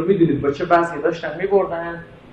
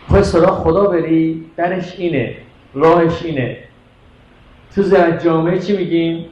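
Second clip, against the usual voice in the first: normal talking voice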